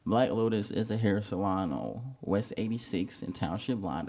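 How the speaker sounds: ordinary talk, nothing marked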